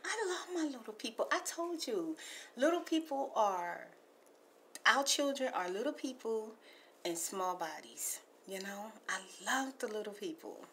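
soft hushed ASMR messages. Acted. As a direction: talk gently like ASMR to a caller. Soft ASMR voicemail talk.

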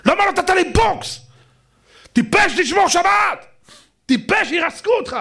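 high, complaining voice of a scolded congregant; pleading with the rabbi. Pretend. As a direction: cry out, asking why he wasn't struck. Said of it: "ואמרת לי".

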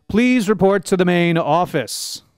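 usual speaking voice

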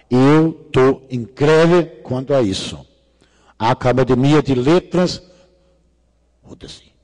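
ordinary delivery

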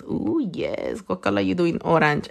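ordinary talk, nothing marked

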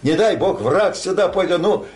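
ordinary delivery

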